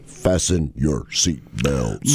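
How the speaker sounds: slow, low robotic car voice; flat robot car voice. Retold or imitated. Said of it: "that creepy robot voice".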